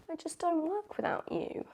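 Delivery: high, slow, soft film-line voice; lightly mocking romantic quote; movie quote impression. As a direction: swoon the line like a film heroine. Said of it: "It's from Bridget Jones' Diary".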